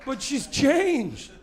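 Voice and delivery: very slow, high mournful wail; mock lament. Comic mourning his changed wife, slow high mock wail. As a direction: drag it out like a tragedy. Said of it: "mock lament".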